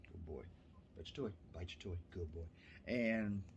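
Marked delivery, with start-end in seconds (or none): 0.1-2.5 softer, lower coaxing; soft praise to puppy. Real, not acted. Soothing puppy.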